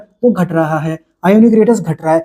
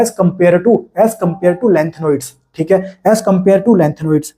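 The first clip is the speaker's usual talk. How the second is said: slow dictation; much slower than usual. Shown as a demonstration. Teacher dictates a key phrase.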